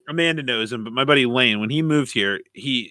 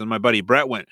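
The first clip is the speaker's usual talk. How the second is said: ordinary talk, nothing marked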